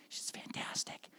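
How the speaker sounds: breathy whispered aside; hushed praise. Retold or imitated. Guide whispers praise of the driver's wife. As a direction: whisper it like a secret.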